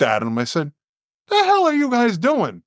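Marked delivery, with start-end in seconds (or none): 1.3-2.6 sudden high-pitched yell at the players; furious yell. Retold or imitated. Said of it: "I just lost it".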